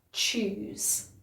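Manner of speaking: a firm ch in choose; hard ch. Shown as a demonstration. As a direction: choose with a hard ch, tongue to the roof of the mouth. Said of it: "choose is a harder sound".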